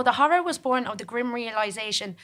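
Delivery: plain reading voice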